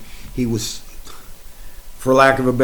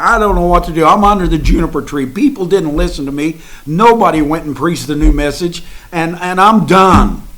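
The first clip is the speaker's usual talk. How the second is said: higher, louder, distraught complaint; breaking down; 5 semitones higher, 6 dB louder than usual. Retold or imitated. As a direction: sound at the end of your rope. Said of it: "He had a nervous breakdown".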